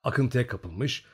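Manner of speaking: ordinary delivery, nothing marked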